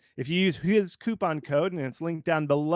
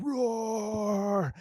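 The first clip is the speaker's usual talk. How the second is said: a lightly voiced high bear roar; a hint of a cartoon bear; 6 semitones higher than usual. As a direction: let a silly bear say roar. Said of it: "a page where it's just like".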